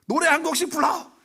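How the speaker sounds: put-on drunk father yelling. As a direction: roar at the sleeping family like a drunk dad. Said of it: "아버지가 와가지고". (잠든 가족을 깨우는 술 취한 아버지, 높고 크게 외치듯)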